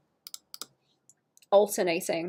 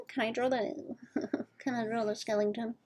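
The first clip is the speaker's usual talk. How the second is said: silly clown; 3 semitones higher, 7 dB quieter than usual. Pretend. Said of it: "Clown voice and granny voice".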